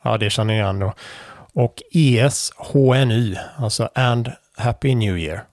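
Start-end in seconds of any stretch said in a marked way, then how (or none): none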